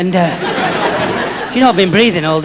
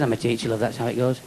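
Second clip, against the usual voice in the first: teacher voice; 5 semitones lower, 11 dB quieter, faster than usual. Retold or imitated. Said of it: "She said".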